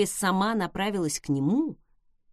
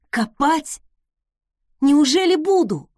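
higher and louder, amazed; excited exclaim; 6 semitones higher, 8 dB louder than usual. A narrator's reading. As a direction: burst out in disbelief. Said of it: "воскликнул он".

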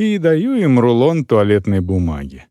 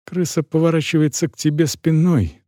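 ordinary delivery

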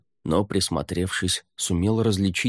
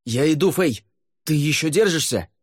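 high, raised call to someone far off; shout; 8 semitones higher than usual. A narrator's reading. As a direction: call out urgently over a distance. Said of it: "и крикнул".

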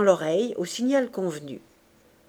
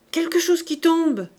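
high startled cry, much louder; cry of alarm; 8 semitones higher, 5 dB louder than usual. A narrator's reading. Thénardier's wife alerting the gang, loud alarmed cry. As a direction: call out sharply that something fell. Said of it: "cria la Thénardier".

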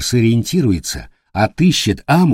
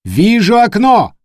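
high, loud, slow lookout shout; loud call; 11 semitones higher, 5 dB louder, slower than usual. Acted. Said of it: "доложил вперёд смотрящий".

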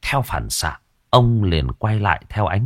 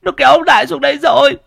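high, fast and louder, near a yell; panicked repeat; 7 dB louder, faster than usual. Acted. Boy insists again to the villagers, frantic and louder. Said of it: "Thằng Ngưu lắp bắp".

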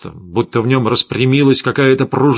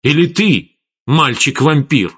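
withering challenge; 4 semitones higher, 4 dB louder than usual. A narrator's reading. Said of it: "испепеляющего взгляда".

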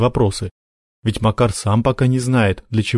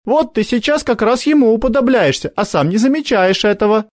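put-on reproachful girl; 12 semitones higher than usual. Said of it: "поморщилась Соня".

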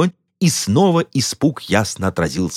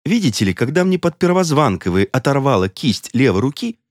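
plain reading voice

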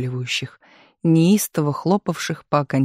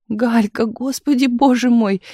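higher, louder cry of joyful shock; overjoyed; 6 semitones higher, 3 dB louder than usual. A narrator's reading. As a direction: greets a friend she thought far away. Friend recognised, overjoyed.

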